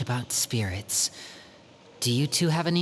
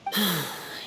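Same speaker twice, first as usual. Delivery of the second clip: resigned sigh; 2 semitones higher than usual. Acted. Resigned to sister's terms.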